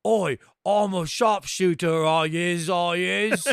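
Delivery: slow, loud mock cockney drawl; cockney accent. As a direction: ham up a cockney sharpshooter. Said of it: "do you want him to try".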